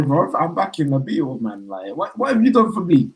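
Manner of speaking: normal talking voice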